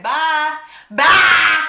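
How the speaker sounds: shouted goodbye to a creep; loud dismissal. Real, not acted. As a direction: shut him down for good. Brushing off the creep.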